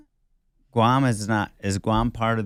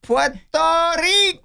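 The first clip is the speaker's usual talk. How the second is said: excited cheer; 7 dB louder than usual. Real, not acted. Cheering for Puerto Rico.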